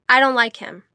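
단호하게; ruling. A narrator's reading. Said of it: "he ruled".

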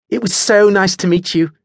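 high-pitched, sunny farewell; cheerful trill. A narrator's reading. Adelaide takes leave of her new acquaintances, cheerfully. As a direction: chirp it, oblivious to any tension. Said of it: "trilled cheerfully".

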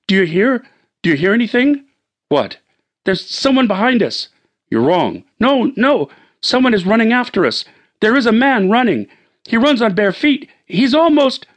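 panicked, hearing footsteps behind; frightened wife. Acted. Wife tells husband someone chases them, frightened.

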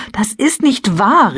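high, drawn-out exclamation of protest; crying out. A narrator's reading. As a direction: call out in indignant denial. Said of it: "rief ich". (empört ausrufend)

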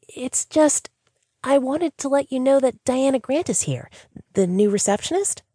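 high, bright voice with a hint of fear. Sandra reporting to her stern boss, bright but uneasy. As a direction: stay cheerful but let the nerves show. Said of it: "the brightness in her voice now had a trace of fear in it".

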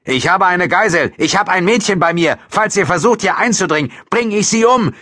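loud, high-pitched hostage-taker; desperate menacing shout; shouted threat. A narrator's reading. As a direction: yell threats from behind a door. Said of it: "den Mann von drin rufen".

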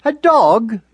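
lightly voiced proper mother dog; Mother's voice. A narrator's reading. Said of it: "she used to say".

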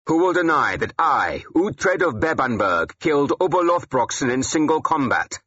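high, ringing challenge; shouted. A narrator's reading. Warrior challenging anyone to deny his kill, shouting. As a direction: proclaim it loudly, daring denial. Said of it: "I shouted".

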